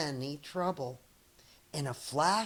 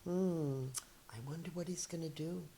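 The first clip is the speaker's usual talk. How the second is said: quiet aside; 8 dB quieter, faster than usual. Acted. Onlooker muttering aside.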